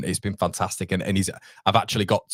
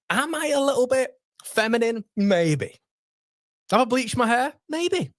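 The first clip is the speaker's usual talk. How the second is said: high put-on camp voice, teasing himself; mock camp voice; 13 semitones higher than usual. Joking to viewers about being feminine, in a mock camp voice. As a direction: play up a camp voice for a laugh.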